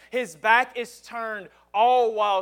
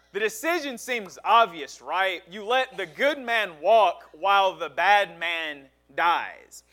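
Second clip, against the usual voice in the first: normal talking voice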